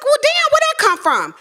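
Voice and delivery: very high, loud, stunned disbelief; shocked outrage. Retold or imitated. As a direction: react like the rug was pulled out. Shocked at mother's refusal.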